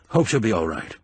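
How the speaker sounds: ordinary delivery, nothing marked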